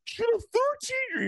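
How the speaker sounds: very high squeaky mock Peterson; mock Jordan Peterson. Friend mocks Jordan Peterson freaking out, very high squeak. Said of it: "He's like".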